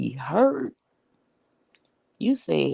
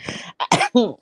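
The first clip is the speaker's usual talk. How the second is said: loud helpless laugh; real laugh, high and loud; laughing fit; 6 semitones higher, 4 dB louder than usual. Real, not acted. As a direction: burst out laughing. After the phone story.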